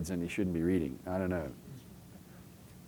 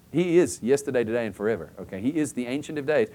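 ordinary talk

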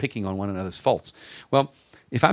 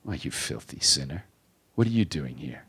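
accusing voice of Satan; 7 semitones lower, 4 dB quieter than usual. Acted. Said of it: "there is a voice that does say that".

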